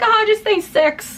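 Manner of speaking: high, slow, offhand mock voice; careless marketer voice. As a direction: toss it off like it doesn't matter. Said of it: "someone's just like".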